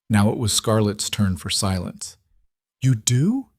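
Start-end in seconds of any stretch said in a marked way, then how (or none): none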